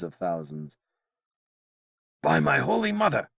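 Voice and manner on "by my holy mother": sudden high gasp of shock; gasped oath. Acted. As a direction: blurt it out, startled and quick. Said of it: "Merlin gasped".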